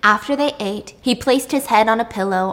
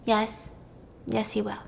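much softer, hushed reply; quiet resignation; 12 dB quieter than usual. Acted. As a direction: let the answer barely come out. Sad admission after the question.

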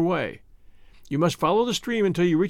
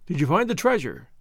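ordinary delivery, nothing marked

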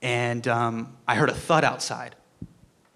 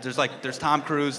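ordinary talk, nothing marked

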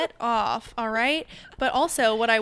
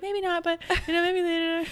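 high polite dodge; 7 semitones higher than usual. Retold or imitated. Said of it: "I was like".

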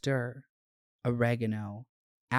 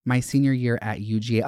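usual speaking voice

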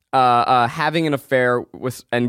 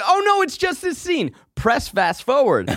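put-on much higher, frantic kid voice; panicked little kid; 11 semitones higher than usual. Kid at the VCR reassuring grandma, high panicked child voice. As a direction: squeal it like a kid caught out. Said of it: "Little baby Zach runs to the V H S".

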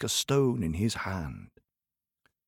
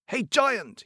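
calling out; 11 semitones higher than usual. Acted. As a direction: shout up to wake him. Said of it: "he called out".

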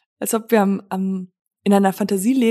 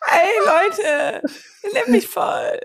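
much higher, teasing whiny imitation; 10 semitones higher, much slower than usual. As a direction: mimic his voice pitched way up. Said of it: "Stimmsitz irgendwie ungefähr hier oben".

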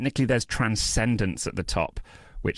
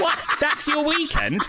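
high, laughing incredulity; mock incredulous; 13 semitones higher than usual. Challenging people who hunt for bodies, mock incredulous. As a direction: shoot up high in comic disbelief. Acted appalled reply to body hunters.

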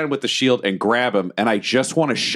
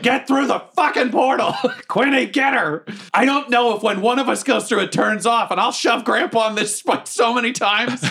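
high-pitched frantic ordering; urgent yelling; 12 semitones higher than usual. Acted. Rushing the party through the portal, frantic yelling. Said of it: "butthole's just like".

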